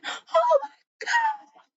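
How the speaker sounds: very high, slow shocked wail; hammed-up horrified gasp; shocked cry. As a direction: squeal it like she just hit someone. Driver hits man with car.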